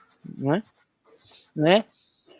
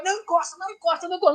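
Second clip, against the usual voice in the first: high, hushed, softer mock panic; mock alarmed warning; 9 semitones higher, 7 dB quieter, faster than usual. German friend warning others off the parcel, hushed panic. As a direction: hiss a frightened "don't touch it" in a high voice.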